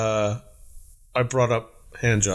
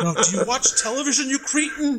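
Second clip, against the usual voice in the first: indignant; 11 semitones higher than usual. Retold or imitated. Acted snooty customer outburst.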